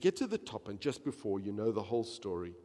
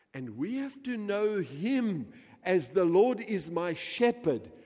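much higher, much louder preaching; preaching at full force; 13 semitones higher, 13 dB louder than usual. Acted. Preacher urging the congregation to know the shepherd, full force. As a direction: drive it home at full volume.

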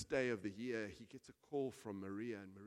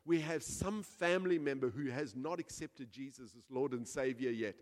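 normal talking voice

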